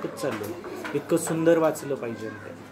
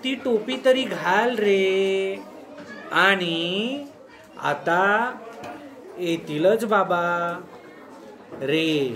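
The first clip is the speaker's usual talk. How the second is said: mother's plea; 4 semitones higher, much slower than usual. Shown as a demonstration. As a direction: drag it out with pauses, a mother insisting. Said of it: "तिथं थोडंसं परत थांबायचं".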